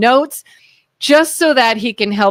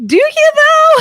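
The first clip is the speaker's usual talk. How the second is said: much higher, louder mock doubt; sceptical retort; 5 dB louder than usual. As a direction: toss it back in disbelief. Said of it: "I was like".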